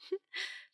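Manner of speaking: high snort of laughter; burst of laughter. A narrator's reading. Silly word amuses her.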